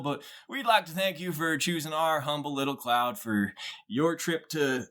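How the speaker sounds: high-pitched airline announcement patter; put-on flight attendant voice; pre-flight announcer. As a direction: deliver the scripted cabin welcome, pitched high. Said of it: "you get a pre-flight announcement".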